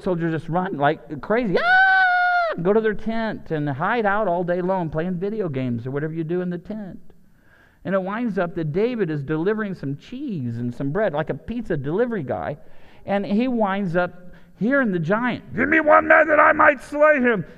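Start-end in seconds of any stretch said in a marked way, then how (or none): 1.6-2.5 high shrieking yelp of panic; mock terrified scream. Soldiers running from the giant, mock scream. 15.5-17.4 booming giant's taunt. Retold or imitated. Goliath taunting the Israelite army, booming loud.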